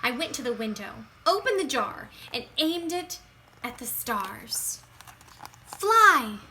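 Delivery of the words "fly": loud, high release call; urgent cry. A narrator's reading. Child at the window freeing fireflies, loud cry. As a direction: fling the word out to the stars.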